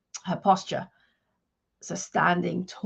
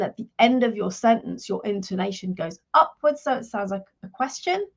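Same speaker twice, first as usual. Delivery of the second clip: uncertain-sounding upturn on "question"; upward intonation. Shown as a demonstration. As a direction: let "question" rise at the end as if asking. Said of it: "like that".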